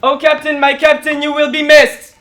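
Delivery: loud, high, rallying call; declaimed film salute. Acted. Friend quoting Dead Poets Society to another, loudly declaimed. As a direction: call it out like a tribute to the captain. Calling out the film's famous salute.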